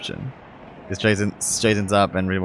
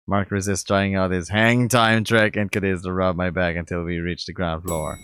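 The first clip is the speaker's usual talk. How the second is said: ordinary delivery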